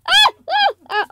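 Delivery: short screams of alarm; repeated frightened yelps; startled screams. Real, not acted. Player startled by something unseen, startled screams.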